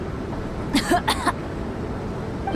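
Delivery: a dry hacking cough; rough cough. Acted. Bennett choking in hot air coughs roughly. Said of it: "my throat".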